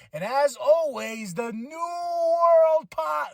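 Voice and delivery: slow, high shouted sign-off; announcer sign-off. Shown as a demonstration. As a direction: stretch it out like a ring announcer. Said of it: "No, no, I'm doing it wrong".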